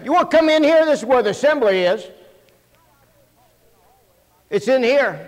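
loud call down the hallway. Retold or imitated. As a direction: shout to the people outside. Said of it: "So I come off the pulpit".

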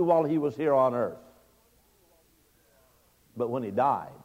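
loud, high, driving sermon voice; fiery preaching. Acted. Preacher pressing the point to his congregation, fiery and loud.

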